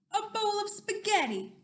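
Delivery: loud, high storybook announcement; big shouted reveal for kids; dramatic challenger reveal. Acted. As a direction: announce it like a mighty opponent. Announces dinosaur's next opponent.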